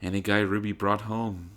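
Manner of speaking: usual speaking voice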